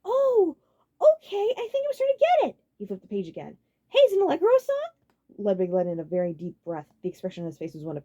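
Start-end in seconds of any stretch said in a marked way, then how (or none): 0.0-2.6 very high, loud, cheery; delighted he understands; happy realization; pleased Roy. A narrator's reading. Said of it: "felt good". 3.9-4.9 high, loud, bright; excited; eager question. A narrator's reading.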